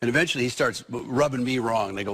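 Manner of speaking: usual speaking voice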